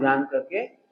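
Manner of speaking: "जान करके" with a questioning rise on करके; fill-in prompt. Shown as a demonstration. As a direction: leave "जान करके" hanging upward, low and soft, for completion. Teacher leaves phrase for listeners to finish. ("करके" पर सुर उठाकर वाक्य अधूरा छोड़ें)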